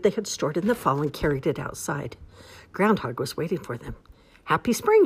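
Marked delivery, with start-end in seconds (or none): 4.4-5.0 high, loud false cheer; lying cheerfully. A narrator's reading. Groundhog lying to the hungry animals, high fake cheer. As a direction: sell the fib brightly, pitch way up. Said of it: "he lied".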